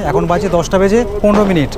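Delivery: ordinary talk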